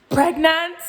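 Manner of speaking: loud, high incredulous question; stunned disbelief. Retold or imitated. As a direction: shout it, still not believing. Repeating shocked word.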